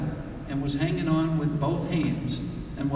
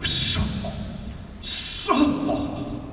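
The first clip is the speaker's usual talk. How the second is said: sputtering engine; 13 semitones higher than usual. Retold or imitated.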